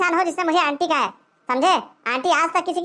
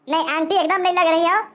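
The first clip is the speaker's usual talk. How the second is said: ordinary talk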